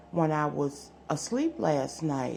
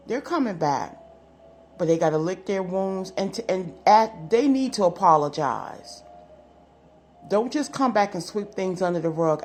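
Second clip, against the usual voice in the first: normal talking voice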